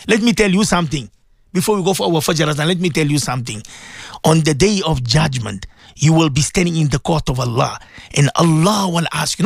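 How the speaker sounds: low-pitched, earnest admonition; solemn preaching. Real, not acted. Warns of judgment day.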